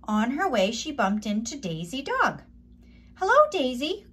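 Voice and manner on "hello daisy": high, lifted hen hello; clucking hen greeting. A narrator's reading. Hen Dora greeting Daisy Dog, high and bright. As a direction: greet her like a cheerful little hen. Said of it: "clucked Dora".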